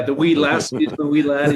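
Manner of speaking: a light mock Irish lilt on the wee lassie; playful Irish accent. Hosting a golf trip to Ireland, hamming a light Irish accent. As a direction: toss off a jokey Irish accent. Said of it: "you got the accent and everything now".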